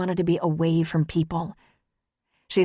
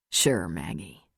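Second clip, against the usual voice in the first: very low, soft, smoldering voice; 12 semitones lower, 5 dB quieter, slower than usual. A narrator's reading. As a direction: drop deep and soft, seductively.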